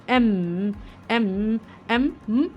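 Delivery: slow एम with a long hum at the end; drawn-out M. Shown as a demonstration. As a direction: stretch एम and let it close into a hum. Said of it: "आखिर में हम्म आता है".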